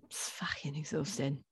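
weary whisper. Real, not acted. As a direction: breathe it out, exhausted.

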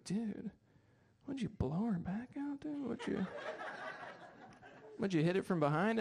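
hushed, nudging bro voice; bro friends teasing. Retold or imitated. Friends ribbing the groom about his fiancee, hushed and teasing. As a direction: lean in like a buddy with a dirty joke. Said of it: "They're like".

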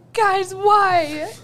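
high, laughing, helpless protest; laughing whine. Retold or imitated. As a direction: squeal it out through a laugh. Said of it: "and I was like".

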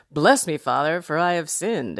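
stand-up comic delivering a confession; jokey, performed take on a solemn formula; like a comedian. Acted. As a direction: play the confession lightly for laughs. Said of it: "Saying it like a complete comedian".

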